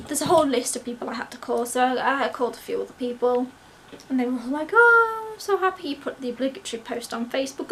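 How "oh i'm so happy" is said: high gush, loud at first; delighted reaction. Retold or imitated. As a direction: imitate friends squealing congratulations. People's delighted reactions retold.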